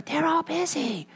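put-on very high, hushed child voice; insistent little boy. Little boy interrupts the telemarketer, high and hushed. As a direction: cut in with an urgent stage whisper. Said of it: "still whispering, the little voice said".

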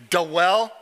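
"dwell" said as "duh-WELL"; deliberately wrong "dwell", vowel after the D; two-syllable dwell. Shown as a demonstration. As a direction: split "dwell" into two beats, rising. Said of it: "So if I say".

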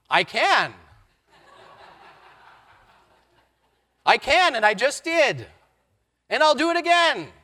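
loud, high, triumphant insistence; insistent. Acted. Pastor insisting he can forgive the man, loud and high. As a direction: ring it out with joyful certainty.

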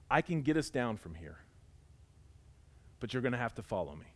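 low, soft, measured reassurance; very calm. Retold or imitated. Soldier back from scouting tells his stranded unit, very calmly. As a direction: quote him with quiet, unhurried assurance. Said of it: "he looks at us very calmly".

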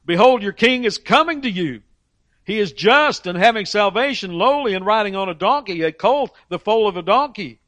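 high, loud proclaiming of scripture; preacher proclaiming the coming king; preaching proclamation. Acted. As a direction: proclaim it to the crowd. Proclaiming Zechariah prophecy.

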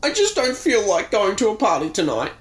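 high weepy whine. Acted. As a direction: sniffle it out sadly. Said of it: "Todd weeps".

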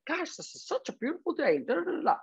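sing-song like you're bopping along; cheery Tigger twin. Retold or imitated. Imitating the happy twin to the viewers, bright and bouncy. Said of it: "He's like".

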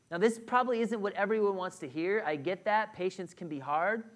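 normal talking voice

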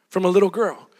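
ordinary talk

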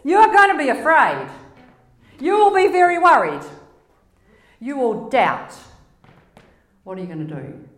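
loud, high, urgent loving warning; impassioned voice of God warning; God's warning. Retold or imitated. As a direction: warn them out of love. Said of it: "This is God's message of love to you".

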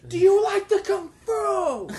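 put-on high, slow accented question; Jackie Chan impression. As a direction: mimic his halting English. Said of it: "He said".

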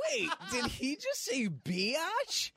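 high, laughing disbelief; surprised laugh. Retold or imitated. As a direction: blurt it out in shocked amusement. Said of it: "And I was like".

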